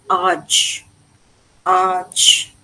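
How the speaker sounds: ARCH as long "ahj", the French AGE ending; French suffix sound. Shown as a demonstration. Teacher showing how the suffix A G E is pronounced, French suffix sound. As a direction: draw out ARCH with an open "ah" and soft j. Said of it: "here we pronounce the suffix".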